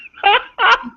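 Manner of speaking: high, loud burst of real laughter; sudden high giggle fit. Real, not acted. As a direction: crack up laughing mid-scene. GM breaks into laughter.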